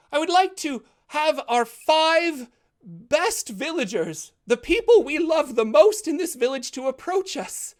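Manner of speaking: put-on high, slow, solemn old priest voice. Old priest blessing the town at the bonfire, high and slow. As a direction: bless the crowd like an ancient holy man. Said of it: "He says".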